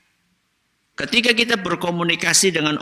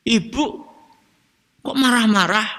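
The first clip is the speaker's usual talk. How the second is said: lightly voiced child, higher pitch; a higher-pitched child questioning mother; child's complaint; 7 semitones higher than usual. Child asking mother about being scolded, mildly plaintive. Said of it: "anak pahamnya ibunya lagi marah".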